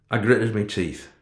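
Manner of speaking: ordinary delivery, nothing marked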